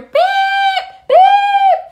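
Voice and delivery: high, loud, long honking beep; car horn beep. Retold or imitated. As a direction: imitate a blaring car horn. Imitates mom's car horn.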